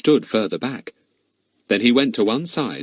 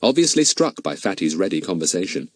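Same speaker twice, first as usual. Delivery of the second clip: ordinary delivery, nothing marked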